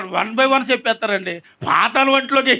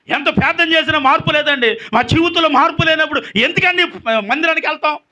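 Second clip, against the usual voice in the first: high, insistent pulpit voice; impassioned preaching; 5 semitones higher, 3 dB louder than usual. Real, not acted. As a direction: press each point home like a preacher. Preacher at raised force.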